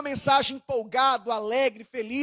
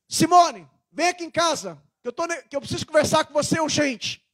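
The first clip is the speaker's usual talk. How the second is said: loud, fast, tense summons; urgent irritated demand; nervous and angry; 5 semitones higher, 4 dB louder than usual. Retold or imitated. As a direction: call her over like you're stressed and angry. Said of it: "Ela sabe que eu tô nervoso".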